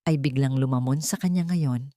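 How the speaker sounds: plain reading voice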